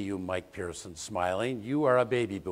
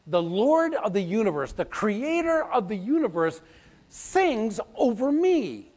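preaching climax; 10 semitones higher, 3 dB louder than usual. Acted. Preacher to congregation, proclaiming God sings over us. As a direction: build to awe.

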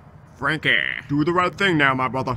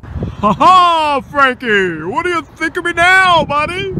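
loud, high, fast taunting; cackling victory taunt; triumphant gloat; 9 semitones higher, 6 dB louder than usual. Acted. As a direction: crow over your buddy.

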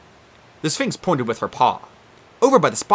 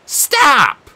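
insistent; 14 semitones higher, 8 dB louder than usual. Acted. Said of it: "Annabeth insisted".

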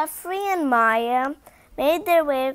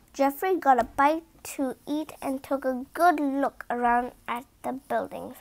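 ordinary delivery, nothing marked

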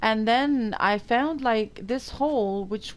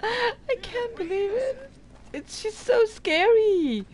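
high, slow, laughing through tears; shaky and laughing, still shocked; crying with laughter; 12 semitones higher, slower than usual. Real, not acted. Shaken after snake scare.

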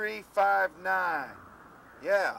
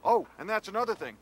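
ordinary talk

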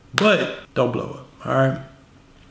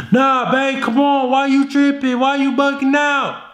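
frantic pleading; 11 semitones higher than usual. Retold or imitated. Boyfriend to girlfriend, high whiny pleading. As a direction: beg her anxiously. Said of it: "How are you gonna respond?".

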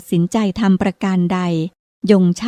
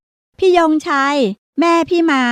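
high-pitched call from the riverbank; child shouting; 7 semitones higher, slower than usual. Acted. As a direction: yell across the water to her brother, slow and drawn out. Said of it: "ประภายืนตะโกนบอกอยู่ริมตลิ่ง". (เด็กหญิงตะโกนเรียกพี่ชายเสียงสูงยานคาง)

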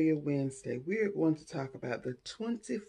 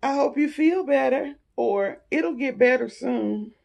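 sing-song well-wishing; 9 semitones higher, 7 dB louder than usual. Retold or imitated. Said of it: "Number seven".

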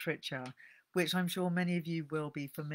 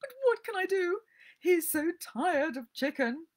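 wailing; 6 dB louder than usual. Acted. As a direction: wail helplessly over the spoiled dog. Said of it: "Missus Pumphrey wailed".

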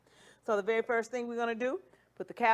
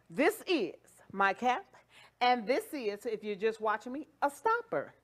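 usual speaking voice

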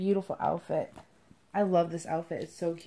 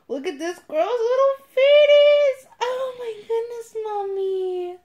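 put-on baby talk; 12 semitones higher, 8 dB louder than usual. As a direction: coo excitedly as if to a baby. Cooing to doll like a baby.

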